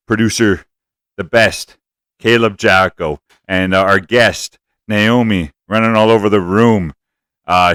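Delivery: loud, upbeat show opener; hyped host intro. Acted. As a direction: boom it out like a radio host. Hyped podcast opening intro.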